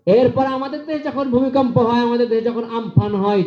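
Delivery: high, ringing preacher's cry; fiery preaching. Acted. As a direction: preach it out to the crowd. Preaching at full force.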